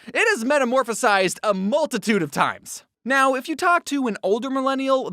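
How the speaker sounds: ordinary talk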